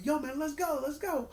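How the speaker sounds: high, hurried mock yell; yelling at the ref. Roman yells at referee to count, high mock yell. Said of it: "he freaking yelled at the referee".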